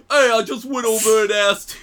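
imitating Cisco. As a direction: do Cisco's eager voice. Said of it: "you know how Cisco talks".

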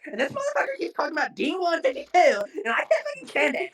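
high, laughing, crying mock rant; ranting girlfriend voice. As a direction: whine like a fed-up girlfriend. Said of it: "she's just in the back".

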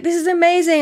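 high, bright rush of joy; excited Rapunzel. Retold or imitated. Rapunzel out of the tower for the first time, high excited gush. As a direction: gush over the freedom. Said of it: "she's like".

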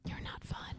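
very soft, voiceless comeback; whispered retort. Real, not acted. Co-host answering the host's grumble, in a whisper. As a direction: whisper it right back.

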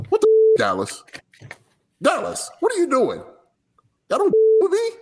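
high-pitched, exasperated outburst; yelling. Acted. Reading out a rapper's rant at Dallas, yelling in high pitch. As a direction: shriek it up high in disbelief. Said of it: "yelling at people is the way".

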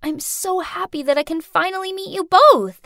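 high, sugary sweet voice; sweetly gushing. A narrator's reading. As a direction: gush sweetly through a grin. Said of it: "then sweetly grinned".

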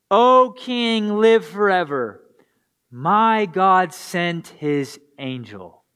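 measured, earnest Bible reading; scripture reading. Acted. Pastor reading Daniel to his congregation, earnest. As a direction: read the passage like it matters.

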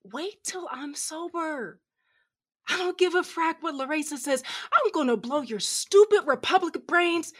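put-on sloppy drunk rising into a yell; drunken tirade. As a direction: rant at her like a furious drunk. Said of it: "my tirade".

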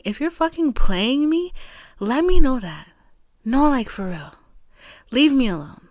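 calling him out. Real, not acted. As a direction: raise the voice and tell him straight. Calling out the boy.

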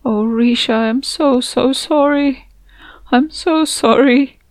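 high, slow, tearful apology; choked out. Acted. Narrator comforts the grieving mother, choked with tears. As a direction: choke the words out through tears. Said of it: "I choked out".

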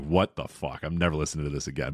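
lightly acted, low-pitched disbelief; put-off new listener. Host imagines a first-time listener quitting, low disbelieving quote. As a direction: drop low as a mildly disgusted newcomer. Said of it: "somebody listening for the first time".

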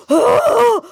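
high, loud gasping "oh, oh"; choking gasps. Retold or imitated. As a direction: gasp like someone choking. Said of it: "I hear this horrible sound".

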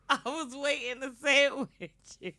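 real helpless laughter through the words, high-pitched; laughing. Real, not acted. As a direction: crack up while getting the words out. Cracking up after missing the sign-off.

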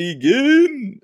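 very high, stretched-out arcade call; hammed-up tournament start call; fighting game announcer. Mimics the Mortal Kombat game call.

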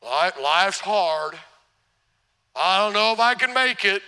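low, slow mopey voice; Eeyore. Pretend. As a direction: sigh it out like a gloomy donkey. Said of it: "Eeyore's like".

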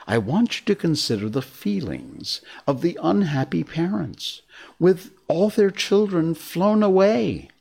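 high, imploring storyteller; Wendy telling a story. A narrator's reading. Wendy asking the boys to pity the parents, imploring.

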